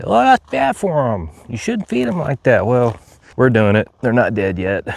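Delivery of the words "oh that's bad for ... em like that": mocking voice. Mocking critics who scold feeding.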